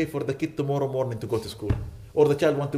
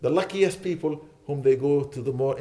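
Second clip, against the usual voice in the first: usual speaking voice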